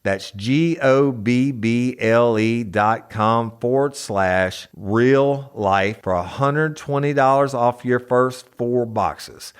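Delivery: slow, loud ad read. Acted. Host reading the sponsor spot, slow loud ad read. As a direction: sell it hard like a radio spot.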